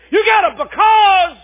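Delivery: high, loud refrain shouted again; preaching refrain. Acted. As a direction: punch the refrain home. Refrain repeated.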